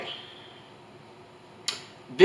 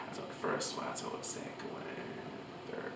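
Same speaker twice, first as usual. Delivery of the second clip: counting under her breath; whispered to self; 24 dB quieter, faster than usual. Real, not acted. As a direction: whisper it to yourself, trying to remember. Muttering count to herself.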